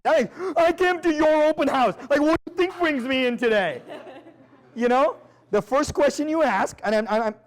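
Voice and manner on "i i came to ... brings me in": higher, put-upon buyer retort; a pitched-up, exasperated house hunter; mock buyer voice. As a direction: play the annoyed visitor, higher.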